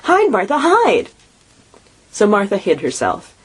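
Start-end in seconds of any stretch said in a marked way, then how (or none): 0.0-1.1 put-on much higher, hurried kids urging; urgent children. A narrator's reading. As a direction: push her to hide quickly. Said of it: "cried the two young Cratchits".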